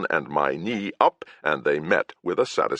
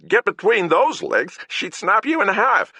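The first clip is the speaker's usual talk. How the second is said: much higher, louder leering knight voice; Hole, coarse knight; 4 dB louder than usual. Acted. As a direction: jeer it like a crude thug. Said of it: "agreed Hole".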